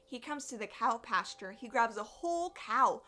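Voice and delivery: ordinary delivery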